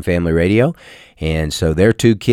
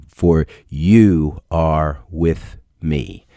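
slow reverent reading; 3 semitones lower, much slower than usual. Shown as a demonstration. Repeats verse, slow reverent.

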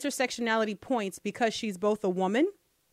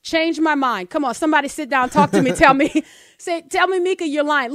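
louder and fast, daring anyone to argue; loud challenge; 4 semitones higher, 7 dB louder than usual. Real, not acted. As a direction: call out the audience to prove you wrong.

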